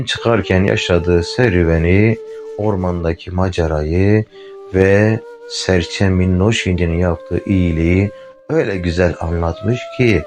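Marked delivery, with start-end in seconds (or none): none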